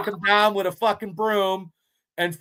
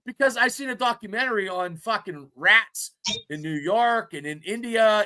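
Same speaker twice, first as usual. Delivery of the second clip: ordinary delivery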